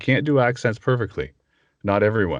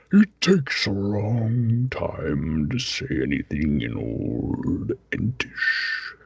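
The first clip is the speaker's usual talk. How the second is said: Treebeard voice; much slower than usual. Retold or imitated. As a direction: draw out each word like an ancient tree. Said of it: "my Treebeard one".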